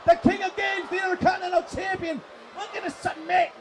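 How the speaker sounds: high, urgent submission call; excited play-by-play. Real, not acted. Commentator calling a sharpshooter submission attempt, urgent. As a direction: keep the pitch up as the hold drags on.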